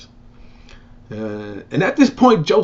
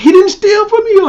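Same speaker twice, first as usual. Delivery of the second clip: high, loud desperate protest; pleading brothers; 8 dB louder than usual. Retold or imitated. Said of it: "they was kinda like".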